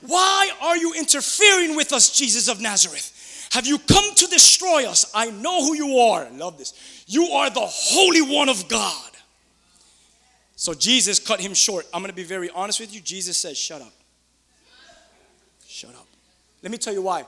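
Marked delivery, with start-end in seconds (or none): 0.0-6.3 slow, raised cry of challenge; shouting demon-possessed man. A narrator's reading. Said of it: "began shouting". 7.1-9.1 slow, dramatic raised declaration; shouting demon-possessed man. A narrator's reading. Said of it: "began shouting". 13.6-16.1 low, hushed order to be silent; quiet command. Acted. Acts Jesus silencing the demon.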